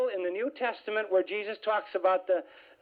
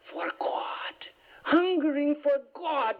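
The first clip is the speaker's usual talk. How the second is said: lightly voiced lofty preacher, drawn out; a hint of the exalted speaker, savouring the words; exalted French preacher; 8 semitones higher, slower than usual. Mimicking the retreat leader's lofty phrase, lightly exalted. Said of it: "it's very exalted".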